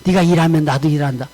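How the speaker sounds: low, measured voice of God; solemn retold divine answer; God speaking. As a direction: state it as God, pitch dropped, unhurried first half. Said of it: "그런데 하나님이".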